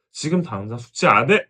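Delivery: imitated loud yell at the child; yelling mom. Mother losing patience and yelling at child over homework, imitated yell. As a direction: bark the order like a fed-up mother. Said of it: "결국 소리를 지르게 되죠".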